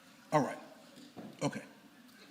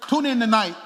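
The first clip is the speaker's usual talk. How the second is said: loud call; 3 semitones higher, 6 dB louder than usual. Real, not acted. Preacher calls out announcement.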